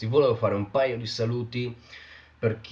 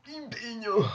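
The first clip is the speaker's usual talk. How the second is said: squeaky high word. Pretend. Parola finale messa in risalto.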